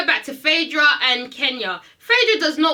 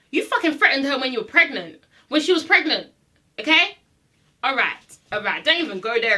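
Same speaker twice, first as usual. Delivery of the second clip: usual speaking voice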